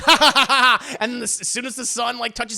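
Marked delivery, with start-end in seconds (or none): 0.1-1.0 loud, high triumphant villain laugh; evil cackle. Retold or imitated. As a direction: cackle like a gloating monster. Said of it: "And the guy's like".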